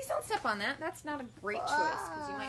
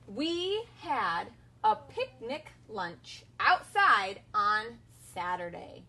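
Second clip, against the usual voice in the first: slow, loud, word by word; slow model reading; 6 dB louder, much slower than usual. Shown as a demonstration. Teacher modeling a sentence for kids to repeat. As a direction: give it clearly for echoing. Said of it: "Your turn".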